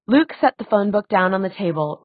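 ordinary delivery, nothing marked

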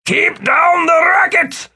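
high, loud, drawn-out yell; pained shout. A narrator's reading. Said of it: "the toll his own voice had taken".

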